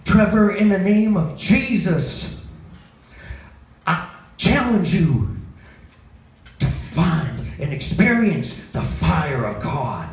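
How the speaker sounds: slow, possessed southern preacher, lightly done; a hint of a Southern pastor drawling a blessing; southern preacher accent. Pastor praying over Trevor, a hint of Southern drawl. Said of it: "he talks with his southern accent".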